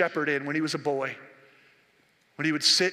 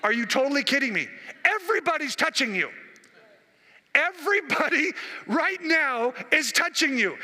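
high, exasperated disbelief; incredulous, almost laughing at him; a high, mocking are-you-kidding; disciples in disbelief; 7 semitones higher than usual. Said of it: "the disciples go".

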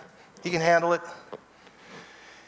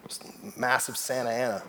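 ordinary talk, nothing marked

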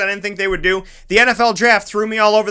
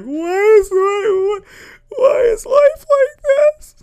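hammed-up high squealing sob; very high-pitched fake crying; losing it; 14 semitones higher, slower than usual. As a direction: wail it in mock despair. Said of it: "I'm just losing it".

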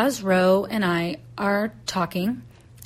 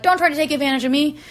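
higher, louder, rapid retold protest; indignant warning; 7 semitones higher, 5 dB louder, faster than usual. Homeowner warning the rake guy, indignant. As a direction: fire it off as in a spat. Acted haggling quarrel.

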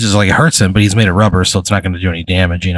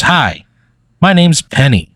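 higher-pitched voice for a friendly introduction; Penny; 7 semitones higher, slower than usual. Acted. Pen girl introduces herself to the newcomer, high-voiced greeting. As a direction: introduce yourself as a perky pen character, pitched up. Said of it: "she turns to you, she goes".

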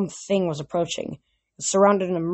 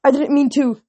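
alarmed apology; 8 semitones higher, 7 dB louder than usual. A narrator's reading. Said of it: "turning pale".

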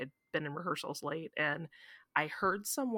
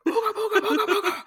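high, loud crazed booga babble; crazed screaming; 10 dB louder, faster than usual. Retold or imitated. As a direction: go full unhinged cartoon panic. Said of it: "like Courage the Cowardly Dog".

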